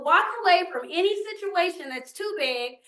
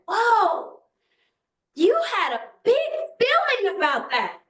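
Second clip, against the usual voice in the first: higher, soothing voice for a little one; gentle to toddler; 5 semitones higher than usual. Retold or imitated. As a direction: coax him out from under the table. Grandmother soothing upset toddler.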